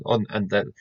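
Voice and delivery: ordinary talk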